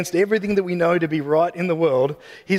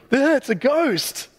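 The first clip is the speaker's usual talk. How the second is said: higher, slow startled gasp of fear; mock frightened cry; 7 semitones higher, slower than usual. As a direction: play the scared disciple seeing a ghost. Disciples see Jesus on water.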